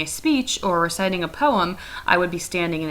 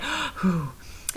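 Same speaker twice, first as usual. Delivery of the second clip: breathless gasp; 5 dB quieter than usual. Shown as a demonstration. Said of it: "you also can't breathe".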